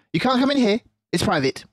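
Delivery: high, loud snapping rat; snappy Rat. Acted. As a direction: snap it out like a cross rat. Rat voiced.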